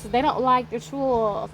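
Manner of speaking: ordinary talk, nothing marked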